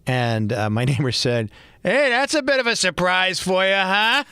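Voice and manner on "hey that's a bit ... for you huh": put-on neighbor's Boston accent, higher; a hint of Boston accent. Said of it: "that's my Boston accent coming out here".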